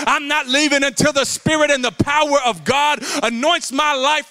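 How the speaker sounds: loud, high, driving preacher's cry; full-force preaching. Acted. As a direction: preach it hard to the whole church.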